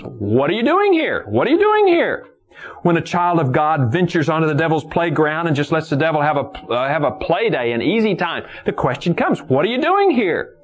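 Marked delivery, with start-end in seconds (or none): 0.0-2.3 high question, climbing on the repeat; challenging preacher's cry. Retold or imitated. Preacher puts God's question to the deserter, challenging cry. 9.4-10.5 high, ringing challenge; challenging preacher's cry. Retold or imitated. Preacher puts the question to God's straying child, challenging cry.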